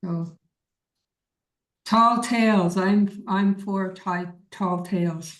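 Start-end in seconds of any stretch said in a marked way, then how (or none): none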